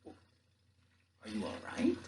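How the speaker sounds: slow, soft, hesitant question; softly drawn-out concern; funny, uneasy voice. Acted. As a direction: ask carefully, taken aback. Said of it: "In a funny voice, he asked".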